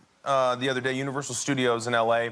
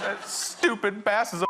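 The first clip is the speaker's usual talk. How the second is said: higher-pitched mock-tearful outburst; crying child, imitated; 6 semitones higher than usual. Son told to get it out, imitated tearful outburst. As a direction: let it out like an upset little boy. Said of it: "continues to cry".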